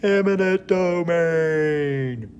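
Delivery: high, very slow, drawn-out sing-song; Seinfeld "the voice". Shown as a demonstration. Teacher closing the example in the goofy voice. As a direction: drag "Eminent domain" out in a goofy high voice. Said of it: "as Jerry Seinfeld likes to say".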